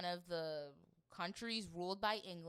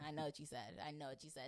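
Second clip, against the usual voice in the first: low knowing mutter; 5 semitones lower, 7 dB quieter, faster than usual. Retold or imitated. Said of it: "It's like".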